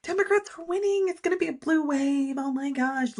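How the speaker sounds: retold excited squeal over the election win; giddy past self. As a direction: gush like an overjoyed fan watching results come in. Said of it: "I was like".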